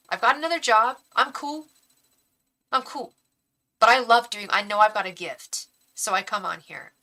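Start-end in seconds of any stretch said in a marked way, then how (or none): 0.1-5.7 getting emotional. Real, not acted. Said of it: "I'm getting all emotional".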